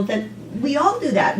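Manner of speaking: ordinary delivery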